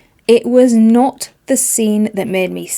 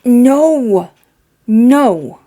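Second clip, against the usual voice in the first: loud no; 3 semitones higher, 6 dB louder than usual. Real, not acted. Outburst at hated trope.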